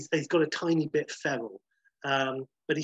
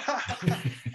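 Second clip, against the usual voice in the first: hearty laugh; 5 semitones higher than usual. Real, not acted. After Blessed impression.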